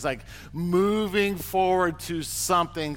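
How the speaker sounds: usual speaking voice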